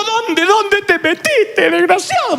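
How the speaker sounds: high, slow frantic scolding mother. Retold or imitated. Mother finds her lost son at the mall, frantic scolding. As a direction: scold like a panicked mom who found her kid. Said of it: "Te diré cuál fue la de mi mamá".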